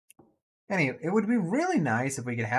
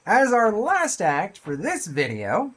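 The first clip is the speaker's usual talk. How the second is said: mock grandeur; 7 semitones higher, 5 dB louder, slower than usual. Real, not acted. Dramatic announcement.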